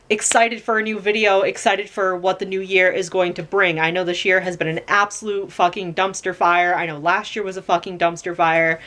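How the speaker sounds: normal talking voice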